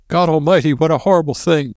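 high-pitched hysterical lament; Gold's hysterical whimper. A narrator's reading. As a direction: wail it high, close to panic. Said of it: "his voice whimpering hysterically".

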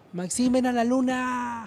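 high, drawn-out playful call. Pretend. Host inviting Maxim to the moon, calling out. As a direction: sing out the invitation, stretching the last word.

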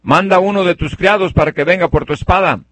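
high, loud call across the distance to the king. Retold or imitated. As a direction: shout it so the king hears from far away. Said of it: "le grita, le dice".

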